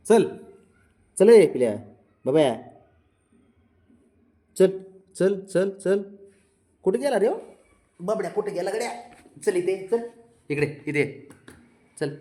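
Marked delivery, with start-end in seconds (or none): 1.2-12.2 high calling. Real, not acted. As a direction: call him out playfully. (उंच आवाजात लाडिक हाक)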